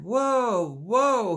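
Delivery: bellowed whoa; roaring. A narrator's reading. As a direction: roar the oxen to a stop. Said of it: "he roared".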